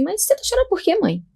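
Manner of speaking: doctor puzzled. Retold or imitated. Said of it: "E aí ela falou assim".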